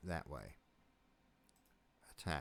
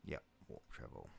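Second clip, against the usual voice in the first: whispered; 12 semitones lower, 16 dB quieter, faster than usual. Real, not acted. Repeats menu option under breath.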